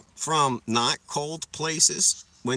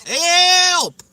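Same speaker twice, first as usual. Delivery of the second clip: high, loud mock cry for help; calling for help; 11 dB louder than usual. Lost in the weeds calling the cops, high loud yell.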